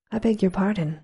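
ordinary delivery, nothing marked

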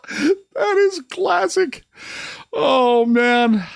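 very high, slow through laughter; high laughing. Real, not acted. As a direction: squeak it out laughing. Laughing at name.

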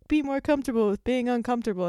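quick, sing-song cliche quote; mocking cliche. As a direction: rattle it off like a tired slogan. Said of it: "a cliche saying where people are like".

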